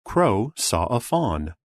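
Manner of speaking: ordinary delivery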